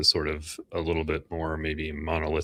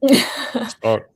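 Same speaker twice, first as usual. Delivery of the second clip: mock hype; 9 dB louder than usual. Mock hyping a guest invite.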